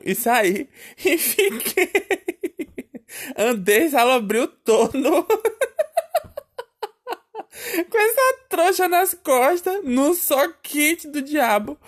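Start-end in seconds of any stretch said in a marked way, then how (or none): 1.0-11.8 laughing through the words; high, drawn-out telling cracked up by laughter. Real, not acted. Storyteller recalling wandering off with a bundle, laughing. Retelling own misery, breaking into laughter.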